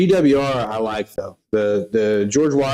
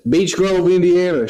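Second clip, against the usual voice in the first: put-on louder, high-pitched imagined Indiana accent; imagined local accent; 7 semitones higher, 3 dB louder than usual. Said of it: "I don't know if that was your accent".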